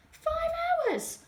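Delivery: astonished exclaim. A narrator's reading. Reader exclaims to the children in high mock disbelief. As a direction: cry out "Five hours!" in high disbelief. Exclaims at cat's long sit.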